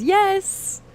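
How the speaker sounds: high, bright yes; excited yes. Retold or imitated. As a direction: squeal the yes. Reading Anders' chat.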